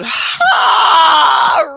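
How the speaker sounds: loud, high, drawn-out "Ha!"; long drawn-out laugh. Retold or imitated. Poet imitating her mama's laugh: long, loud, high "Ha!". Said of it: "A long drawn out".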